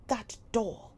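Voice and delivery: loud scornful cry; cried out. A narrator's reading. Miss Minchin crying out at Sarah's doll, loud. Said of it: "cried Miss Minchin".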